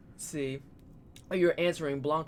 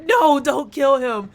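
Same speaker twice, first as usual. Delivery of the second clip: higher, louder, slow panicked plea; panicked chat yell; 11 semitones higher, 13 dB louder, slower than usual. Retold or imitated. Streamer voicing her panicked chat, loud slow plea. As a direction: beg them to stop, loud and drawn out. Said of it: "And they're like".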